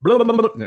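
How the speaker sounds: high, loud blubbering noise; goofy babble. Pretend. Co-host clowning as the lightning round starts, goofy babble. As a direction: burble it out like a silly fanfare.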